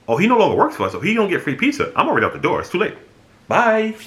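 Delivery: squeaky dismissive boss brushing him off; mocking boss. Boss refusing pizza to ex-worker, high mock voice.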